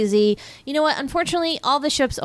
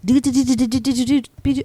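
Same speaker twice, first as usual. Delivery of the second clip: Morse beeps; 5 semitones higher, 4 dB louder, faster than usual. Retold or imitated. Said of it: "Morse code that's like".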